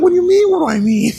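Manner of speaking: very high, loud and fast; hammed-up indignant son; son snapping back.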